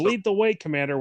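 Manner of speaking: talking through a mouthful of cheeseburger; words muffled round a big bite; mouth full. Acted. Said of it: "with a mouthful".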